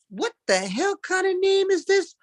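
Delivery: high, disbelieving teacher voice; a teacher who can't believe the name; incredulous teacher. Retold or imitated. Fifth grade teacher at roll call, high and incredulous. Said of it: "my fifth grade teacher is calling roll call".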